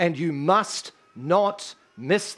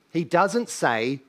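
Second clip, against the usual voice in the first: usual speaking voice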